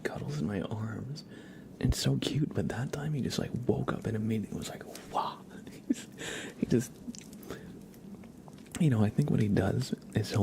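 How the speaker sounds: hushed stream whisper. Real, not acted. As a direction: whisper it like you might wake the cat. Whispered chat to viewers.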